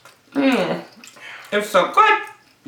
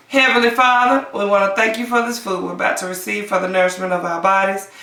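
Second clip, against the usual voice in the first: prayer; 3 semitones lower than usual. Acted. Saying grace.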